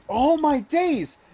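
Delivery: high, loud, drawn-out outcry; imitated scream. Teammate screaming in anger during a game, imitated high scream. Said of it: "She starts screaming".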